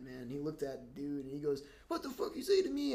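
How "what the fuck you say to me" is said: high-pitched mock confrontation; retold hothead. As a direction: imitate a hothead friend squaring up, pitched way up. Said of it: "he's a hothead".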